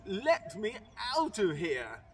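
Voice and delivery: ordinary delivery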